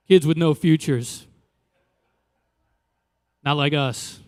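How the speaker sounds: ordinary talk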